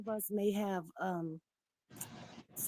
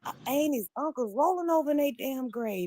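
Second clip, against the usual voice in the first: high, loud outraged scolding; indignant rant; 3 semitones higher, 10 dB louder than usual. Retold or imitated. Scolding her cousin, high, loud and outraged. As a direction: scold him for shaming the family. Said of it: "I say".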